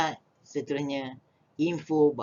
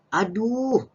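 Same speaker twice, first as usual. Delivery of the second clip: drawn-out, high, loud "Aduh"; cry of pain; 9 semitones higher, 5 dB louder than usual. Shown as a demonstration. Teacher gives pupils the word for hurting, pained exclamation. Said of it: "bila sakit tadi, kita nak gunakan perkataan apa".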